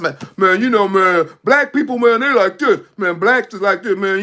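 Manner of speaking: loud, emphatic mock griping; loud fussing. Speaker acting out people fussing about each other, loud and animated. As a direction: imitate someone fussing loudly about their own people. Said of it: "and you'll be fussing".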